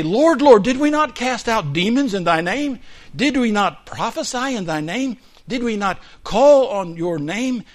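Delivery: loud, high pleading cry; mock desperate plea. People before Jesus on judgment day, loud desperate pleading. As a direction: beg to be recognized.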